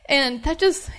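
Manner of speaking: real tears welling up mid-sentence; moved to tears, voice breaking high; choked up. Real, not acted. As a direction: voice cracking with tears. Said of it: "I'm sorry I'm crying".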